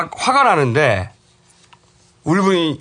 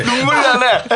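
switching impression voices; 8 semitones higher than usual. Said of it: "박원순이잖아".

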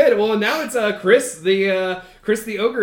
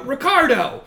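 mock yell; 8 semitones higher, 3 dB louder than usual. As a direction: summon an underling with a restrained yell. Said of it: "he yells".

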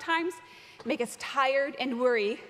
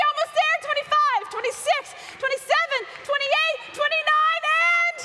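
excited counting; 12 semitones higher, 4 dB louder than usual. Real, not acted. Pastor cheering the kids running on the spot, shouting. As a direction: yell the numbers like a coach at a race. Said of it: "we're gonna start counting one to thirty".

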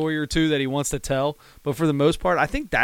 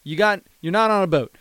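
raised, higher-pitched comic rant; mock scolding; 4 semitones higher, 4 dB louder than usual. Comedian berating a man in boat shoes, mock exasperated rant. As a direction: imitate a comic loudly telling someone off.

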